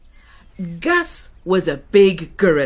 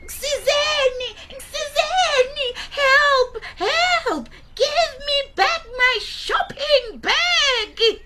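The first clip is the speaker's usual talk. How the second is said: put-on very high, slow old woman crying for help; old granny shouting; slower than usual. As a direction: shriek for help like a frantic granny. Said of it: "Gogo shouted".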